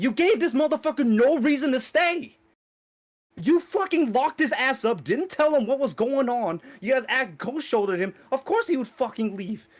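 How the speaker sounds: high-pitched, indignant tirade; exasperated rant. Retold or imitated. Viewer scolding the characters for shunning Shinji, indignant high rant.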